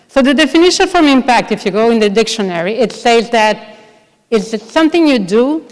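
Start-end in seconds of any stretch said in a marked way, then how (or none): none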